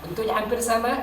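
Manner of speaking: normal talking voice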